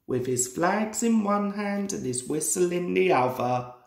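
usual speaking voice

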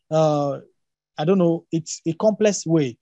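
ordinary talk

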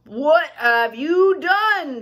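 acted furious scolding, drawn out; angry shout. A narrator's reading. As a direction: bellow each word like an outraged gnome. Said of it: "shouted Crooked Root angrily".

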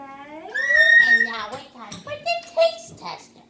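high, loud, slow showbiz announcement; announcer voice. Pretend. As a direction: announce it like a game show host. Announcing the taste test.

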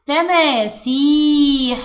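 تماسيح with long a and i drawn out slowly; stretched reading. Shown as a demonstration. Pupil reads the example word, vowels stretched. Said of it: "كلمة".

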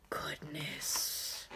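unvoiced embarrassed whisper; hushed oops. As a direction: breathe it out sheepishly. B's mistake, then startled hush.

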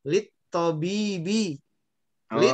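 slow "Lit tobibi", lam merged into the t; "Lit tobibi" as a measured teacher's demo; model Arabic reading. Shown as a demonstration. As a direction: stretch the long vowel in "Lit tobibi". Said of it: "Lamnya jangan dibaca".

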